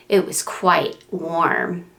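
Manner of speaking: ordinary delivery, nothing marked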